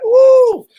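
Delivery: loud high woo of delight; gleeful high whoop; high cheer. Real, not acted. Cheering over the cupcakes.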